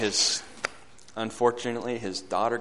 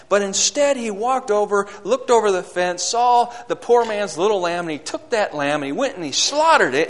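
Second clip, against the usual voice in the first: raised, forceful sermon storytelling; louder, higher pulpit delivery; impassioned preaching; 7 semitones higher, 7 dB louder than usual. Acted. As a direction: preach the story with mounting urgency, easing at the end.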